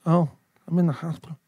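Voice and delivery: lightly voiced mother on the phone; retold mother's voice. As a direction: imitate mum answering, a slight change of voice. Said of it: "She's like".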